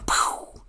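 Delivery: breathy voiceless gunshot noise; imitated gunshot. Cop firing in the retold story, imitated gunshot. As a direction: puff out a pistol shot sound.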